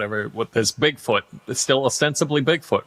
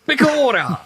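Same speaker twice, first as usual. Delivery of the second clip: high, exclaimed mock Irish accent; fake Irish accent; 12 semitones higher, 3 dB louder than usual. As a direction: burst out with one word in fake Irish. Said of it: "fakes an Irish accent. He goes".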